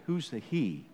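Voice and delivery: ordinary talk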